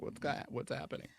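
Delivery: mock alarm at the viewer drop; imitating her own puzzled self; confused. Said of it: "and I was like".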